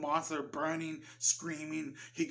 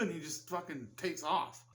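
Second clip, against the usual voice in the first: usual speaking voice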